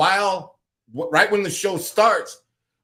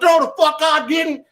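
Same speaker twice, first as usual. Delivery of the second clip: imitating co-host's denial, high; 12 semitones higher, 5 dB louder than usual. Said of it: "And she's like".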